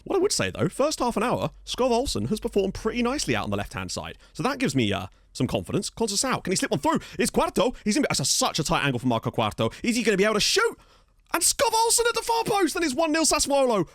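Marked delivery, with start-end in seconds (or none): 5.9-13.9 much higher goal call, building from tense questions; excited play-by-play. Real, not acted. Gamer calls his Sassuolo side's goal, thrilled commentary. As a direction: build to a cry as the goal goes in.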